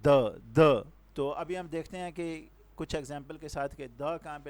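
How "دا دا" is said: "دا" with a relaxed schwa vowel, punched; "the" before consonants. Shown as a demonstration. Teacher showing where to use it, "دا" said as loud "duh". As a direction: say "دا" as a short "duh", firm and loud. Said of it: "ادھر ہم جو ہے نا دا لگائیں گے".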